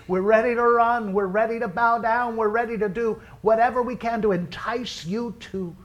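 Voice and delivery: high, loud, urgent plea to God; fervent prayer. Real, not acted. Pastor begging the Lord to visit the service, fervent prayer. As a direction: cry out to the Lord in preaching voice.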